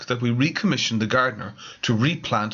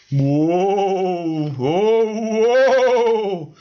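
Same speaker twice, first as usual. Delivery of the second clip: rising eerie wails, louder and higher each time; ghostly moaning; 9 semitones higher, 6 dB louder, much slower than usual. Acted. Ghost haunting the residents' meeting, rising eerie moan. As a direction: moan like a ghost drifting through. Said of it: "said the ghostly voice".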